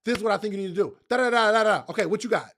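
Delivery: brisk, rapid-fire babble of advice; talking fast. Acted. Speaker rushing through questioners while walking, talking fast. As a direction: rattle it off and move on to the next. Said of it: "I'm talking fast".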